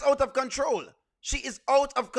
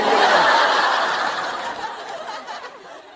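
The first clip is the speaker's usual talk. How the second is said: high, breathy burst of laughter; loud laugh; 12 semitones higher, 5 dB louder than usual. Real, not acted. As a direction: let out a big cackling laugh. Big laugh after own joke.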